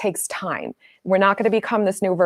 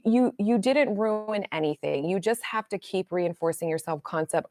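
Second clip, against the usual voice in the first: ordinary delivery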